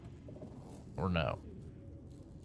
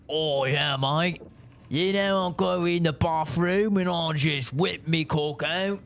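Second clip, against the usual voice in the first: higher mock Aussie bloke, bragging; Australian accent; 4 semitones higher than usual. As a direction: lay on a broad Australian accent. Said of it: "Australian's like".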